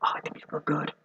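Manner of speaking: anxious hushed whisper, fretting over the pick; nervous whisper. Retold or imitated. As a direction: whisper it like a worried drafter. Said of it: "he's been nervous".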